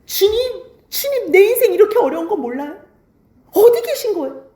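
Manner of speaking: high-pitched plaintive protest; acted complaint. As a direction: mimic someone complaining up to God.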